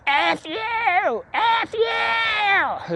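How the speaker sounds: high, raspy imitated scream; mock screaming. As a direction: screech it like the drunk neighbour, without full volume. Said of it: "screaming at him at the top of her lungs".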